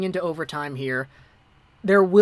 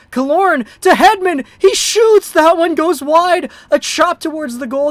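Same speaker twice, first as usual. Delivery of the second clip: higher and louder, voice jumping on "He shoots"; frantic shorthanded scramble call; excited call; 9 semitones higher, 10 dB louder than usual. Real, not acted. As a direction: shout the rush as it happens. Shorthanded rush, near goal.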